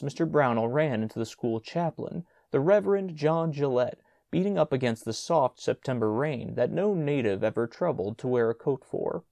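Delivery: ordinary delivery, nothing marked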